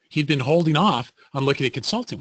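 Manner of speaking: normal talking voice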